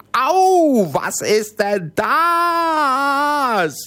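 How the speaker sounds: high, slow wail of pain; giant's cry of pain. Acted. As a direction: yelp as the arrow hits. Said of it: "und der schrie".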